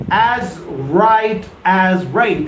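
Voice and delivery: "As right as rain" spelled out slowly, each word weighted; slow model phrase. Shown as a demonstration. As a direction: drill the idiom slowly for the class. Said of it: "What does "right as rain" mean?".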